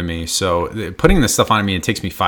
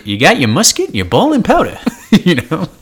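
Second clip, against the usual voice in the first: put-on old-timey prospector voice; 8 semitones higher, 5 dB louder than usual. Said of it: "in that prospector voice".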